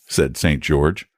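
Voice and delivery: ordinary delivery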